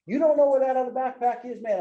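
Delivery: replaying his own blowup at his wife; retold outburst. Husband snapping at his wife over the lost backpack, mock outrage. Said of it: "And I went off the hook".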